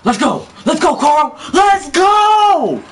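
2 high, loud, rising cheer; 3 build to a full yell; 4 hyped gamer's cheer; 1 real hype cheer. Real, not acted. Cheering a teammate on.

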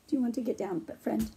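ordinary delivery

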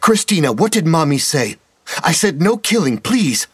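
high, pleading, scolding gently; a mother begging her child to stop. A narrator's reading.

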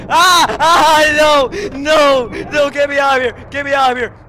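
loud, high panicked screams and pleas; terrified screaming. Real, not acted. Player screaming to get out of a horror game, terrified. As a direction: scream in sheer panic as you flee.

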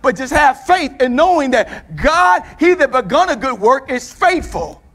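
preacher warning the congregation against pride; building preaching. Acted. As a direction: rise into the warning, leaning on each phrase.

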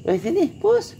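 very high kitty call; calling the cat. Real, not acted. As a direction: sing it up high to lure the cat.

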